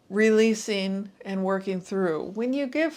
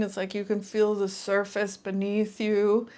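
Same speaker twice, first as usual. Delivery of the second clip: ordinary talk, nothing marked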